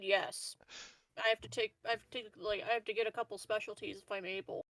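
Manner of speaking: ordinary talk, nothing marked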